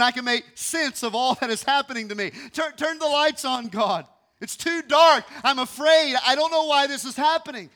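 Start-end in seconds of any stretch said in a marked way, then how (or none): 2.5-7.7 high, loud crying out; loud, high fearful pleading; loud frightened plea. Acted. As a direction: beg God to turn the lights on. Voicing frightened prayer, preaching peak.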